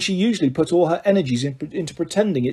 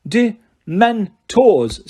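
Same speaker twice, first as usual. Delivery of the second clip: very slow, every sound clear; 5 semitones higher, 4 dB louder, much slower than usual. A narrator's reading. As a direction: spell it out slowly. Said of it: "said Harry slowly and clearly".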